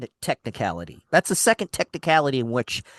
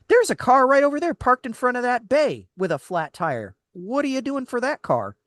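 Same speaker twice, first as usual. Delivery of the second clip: exasperated retort; 8 semitones higher than usual. Retold or imitated. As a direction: push back at the manager, fed up. Annoyed customer confronting the manager.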